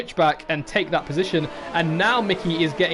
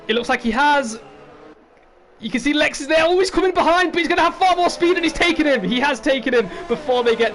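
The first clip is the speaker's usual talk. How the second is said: much higher, louder, rushing the call; excited commentary; 8 semitones higher, 5 dB louder than usual. Real, not acted. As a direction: build to the overtake. Overtake completed live.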